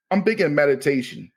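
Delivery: normal talking voice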